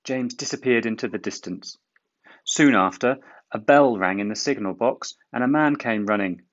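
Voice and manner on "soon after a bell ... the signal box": plain reading voice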